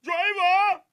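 hammed-up mock Jamaican patois, belted; Jamaican patois imitation. Streamer imitating a Jamaican song he can't understand, mock Jamaican patois. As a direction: belt out garbled Jamaican patois lyrics. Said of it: "them Jamaicans be saying".

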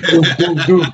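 imitating a pounding heartbeat with a rising doom. Retelling his panic to friends, mimicking a racing heartbeat. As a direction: thump it out like a scared heart. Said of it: "my heart's like".

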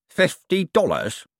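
slow, drawn-out appalled repeat. A narrator's reading. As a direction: sound appalled at the pittance. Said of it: "appalled at the minimum wage of sin".

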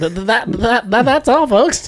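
a second, stronger try at the Porky Pig stutter; cartoon pig stutter, building up; Porky Pig impression. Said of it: "You do it".

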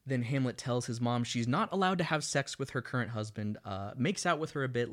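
normal talking voice

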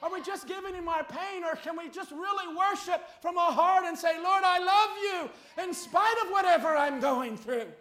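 loud, high, building to a shout; impassioned preaching. Real, not acted. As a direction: press the congregation with rising fervor. Preaches at rising force.

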